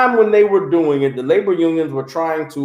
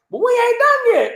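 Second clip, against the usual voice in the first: outraged boss. Retold or imitated. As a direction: shriek the protest like an upset boss. Boss protesting his leaving.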